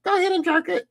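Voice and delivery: high, sneering degrading voice; imitating a JOI video woman; mocking dominatrix. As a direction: taunt him like a scornful mistress. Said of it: "women telling them".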